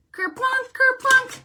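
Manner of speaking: bouncy high kerplunk sound effect; playful sound words. Acted. Host voicing berries splashing into the pot, high and playful.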